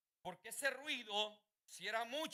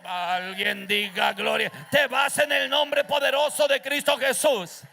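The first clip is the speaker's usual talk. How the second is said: slow, loud commanding shout; rebuking the devil; 4 semitones higher, 19 dB louder, slower than usual. Real, not acted. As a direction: cast him out with full authority. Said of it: "Cuando el diablo a mí me dice". (reprendiendo al diablo a gritos, lento y firme)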